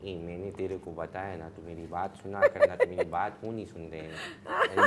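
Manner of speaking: low Memon uncle voice, scolding; a Memon man telling someone off; Memon accent. Pretend. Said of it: "Memoni भी थोड़ा करता है". (मेमन लहजे में डांटना)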